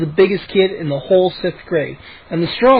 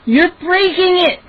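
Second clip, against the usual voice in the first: high panicked cry; shrieking; 12 semitones higher, slower than usual. A narrator's reading. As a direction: shriek in alarm. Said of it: "Mindy shrieked".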